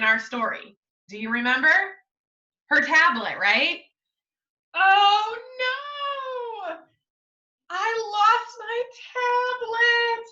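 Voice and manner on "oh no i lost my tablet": put-on high, slow panicked child voice; dismayed child, pitched up; upset girl. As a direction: cry out like a girl who lost her tablet. Girl discovers lost tablet.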